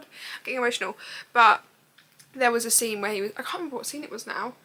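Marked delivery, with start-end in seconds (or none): none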